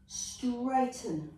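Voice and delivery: normal talking voice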